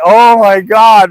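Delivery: acted-out aghast exclamation; retold shock. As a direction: draw it out in dawning horror. Retold shock at missing guard.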